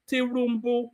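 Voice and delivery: Tinubu said as Tirumbu, a rolled r in the middle; mocking mispronunciation. Commentator mimicking protesters' mispronunciation. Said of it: "Did you hear what he was saying?".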